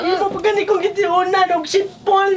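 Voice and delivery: tearful complaint. Acted. Woman accusing the man before the mediator, crying. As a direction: wail the grievance through sobs. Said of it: "Ganina ra yun taon ni siya naghilak".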